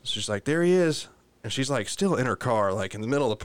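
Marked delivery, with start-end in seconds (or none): 0.4-1.1 high-pitched offhand announcement; grandma calling out. Retold or imitated. Imitating grandma showing off the dog from her car, high call. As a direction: imitate a grandma handing something over.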